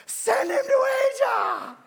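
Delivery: high, loud, slow cry; little Filipino man shouting his prophecy; excited shout. Retold or imitated. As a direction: call it out across the room. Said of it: "That little Filipino guy going".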